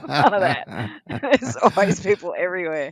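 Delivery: laughing through None of that; laughing while it's said. Real, not acted. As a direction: giggle the words out, amused. Laughing at busy beaches.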